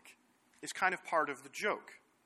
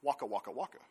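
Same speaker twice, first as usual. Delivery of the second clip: Fozzie Bear imitation; 5 semitones lower, faster than usual. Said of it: "What does he say?".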